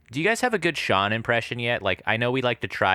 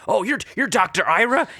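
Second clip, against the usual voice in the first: crazy soldier; 9 semitones higher, 4 dB louder than usual. Retold or imitated. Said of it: "he's like".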